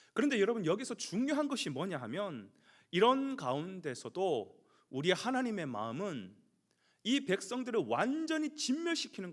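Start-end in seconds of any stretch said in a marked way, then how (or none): none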